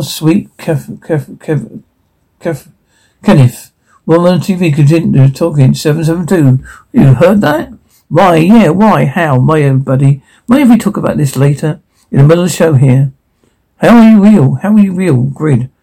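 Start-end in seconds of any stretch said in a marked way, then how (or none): 0.0-2.7 fading to a murmur. Acted. Grieving mother, fading voice. 13.8-15.7 shocked shout. Acted. Shocked outburst at the robot.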